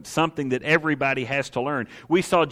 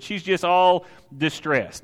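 ordinary talk, nothing marked

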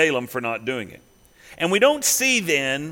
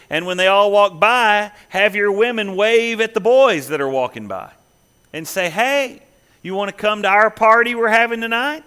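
louder, higher, building pitch of the plan; rising excitement; 6 semitones higher, 6 dB louder than usual. Retold or imitated. Balaam pitching his trap to Balak, getting louder and excited. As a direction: sell the scheme with growing gusto.

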